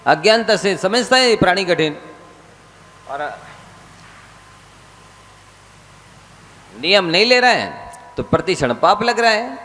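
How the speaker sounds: high, insistent sermon pleading; a monk exhorting his listeners; fervent preaching. Acted. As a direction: press them to take the vow. Preacher urging vows.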